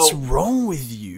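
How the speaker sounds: slow exaggerated disbelief; mock scolding. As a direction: ham up a mocking question. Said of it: "No, I'm joking".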